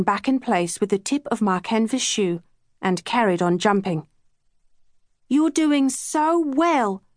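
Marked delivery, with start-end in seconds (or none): 5.3-7.0 put-on higher, slow, cheering little girl; lightly voiced cheering child; Kat calling encouragement. A narrator's reading. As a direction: call out praise to your sister. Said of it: "Kat called".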